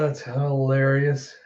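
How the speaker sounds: ordinary talk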